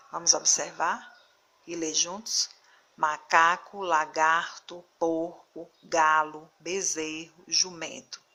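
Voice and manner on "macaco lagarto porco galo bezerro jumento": maCAco, laGARto, juMENto, strong next-to-last syllable; stress before last syllable. Shown as a demonstration. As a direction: read Macaco, lagarto, porco word by word, final O weak. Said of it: "a sílaba mais forte não é a última". (Macaco, lagarto, jumento com a penúltima sílaba forte)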